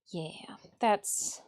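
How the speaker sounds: ordinary talk, nothing marked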